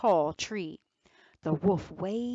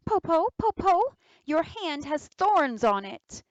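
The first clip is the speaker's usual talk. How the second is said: put-on alarmed child; 9 semitones higher, 6 dB louder than usual.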